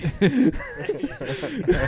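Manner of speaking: high squealing laugh. Real, not acted. Laughs at jive turkey joke.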